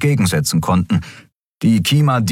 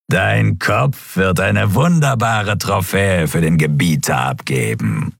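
slow threat, sinking at the end; menacing villain; slower than usual. A narrator's reading. Shadow Skria promising a trophy to his master, menacing.

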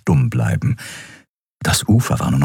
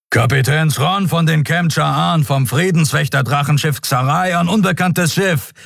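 booming, much higher commanding captain voice; 9 semitones higher than usual. A narrator's reading. Captain hails unknown ship through the cube, booming. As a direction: boom it out like a warship captain. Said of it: "dröhnte es aus dem Kubus".

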